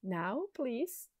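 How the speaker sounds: normal talking voice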